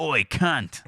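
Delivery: loud, high Australian yell; mock Australian accent. Showing how Australians swear, mock Australian accent. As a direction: call out like a brash Aussie. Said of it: "Like that".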